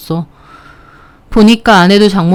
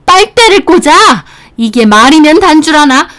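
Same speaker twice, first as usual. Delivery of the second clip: a hammed-up furious mother; enraged mother-in-law; 7 semitones higher than usual. (높은 톤으로 분노하는 장모님)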